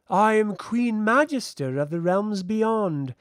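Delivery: high, slow, imperious queen; frosty. A narrator's reading. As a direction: announce the title with icy grandeur. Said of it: "the frost in her voice".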